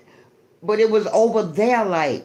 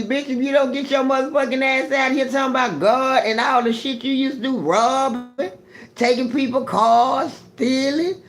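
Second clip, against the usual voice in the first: high, raised mocking retort; imitating street friends; 5 semitones higher than usual. Old friends on the street mock the preaching, high and raised. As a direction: throw their past back in their face. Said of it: "they gonna tell me".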